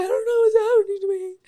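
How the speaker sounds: fast, high, loud wailing; hammed-up panicked bawling; mock crying. Scared patient at urgent care, mock crying. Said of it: "I was literally crying the whole time".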